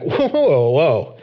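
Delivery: caught-out whoa at himself; startled whoa. Retold or imitated. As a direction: pull up short in alarm. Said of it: "I'm like".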